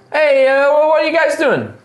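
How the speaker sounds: high, loud halfling voice; halfling worker. Acted. Halfling worker hails visiting strangers, high shouted voice. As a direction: call out high over factory din. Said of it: "This little halfling".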